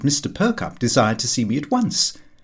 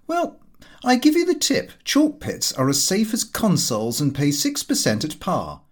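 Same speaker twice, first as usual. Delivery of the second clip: much higher, breezy know-it-all son; cocky young son; 6 semitones higher than usual. Acted. Son airily tipping his father on chalk pits, much higher voice. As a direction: toss off the tip like a smug young swell. Son Lupin gives a stock tip.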